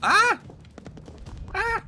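sudden high yelp; yell. Pretend. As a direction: yell just for fun. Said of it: "I just yell, I love it".

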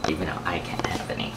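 soft unvoiced whisper; whispered aside. Real, not acted. Vlogger whispering to the camera, regretful.

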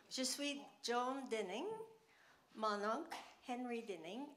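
halting French with an English accent; English speaker struggling through French; broken French. Real, not acted. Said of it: "mon français est pas parfait".

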